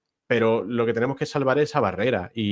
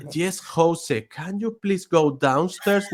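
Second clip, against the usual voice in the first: light Irish lilt, higher and slow; mimicking Irish colleague; 5 semitones higher, much slower than usual. Colleague asks José to go downstairs, lightly mimicked Irish lilt. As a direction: lightly voice a village teacher's request. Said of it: "irlandesa de pueblo cerrado".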